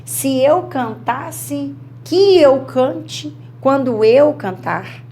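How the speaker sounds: each subjunctive form drawn out slowly; teacher slowing down to show the subjunctive; slow demonstration. Shown as a demonstration. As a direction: spell out "se eu cantasse, que eu cante quando eu cantar" slowly. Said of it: "Olha como a gente conjuga".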